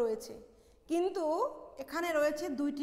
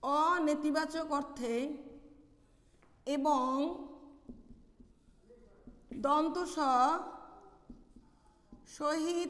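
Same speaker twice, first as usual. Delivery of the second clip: slow dictation with long pauses between phrases; slower than usual. Real, not acted. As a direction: dictate slowly, giving students time to write. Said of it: "পরবর্তী নিয়ম লেখো". (লিখিয়ে দেওয়ার মতো ধীরে, থেমে থেমে)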